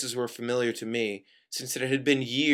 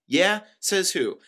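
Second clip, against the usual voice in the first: loud, high-pitched challenge; defiant retort; 8 semitones higher, 6 dB louder, slower than usual. Acted. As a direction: fire back indignantly, pitched up. Defiant retort in acted argument.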